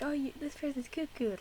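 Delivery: high, soft mocking kid voice; kid teasing. Reactor mimics kids teasing someone as crazy, high and mocking. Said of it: "They're just like".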